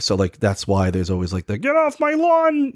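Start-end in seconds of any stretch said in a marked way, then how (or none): 1.6-2.7 high, drawn-out mock yell of an old crank; yelling old guy. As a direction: imitate an old man shooing kids off. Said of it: "old guy yelling at the, the kids".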